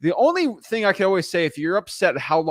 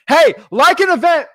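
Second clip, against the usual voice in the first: hype man voice; 10 semitones higher, 8 dB louder than usual. Real, not acted. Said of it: "Let's get the hype man for us going".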